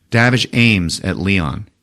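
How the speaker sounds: ordinary delivery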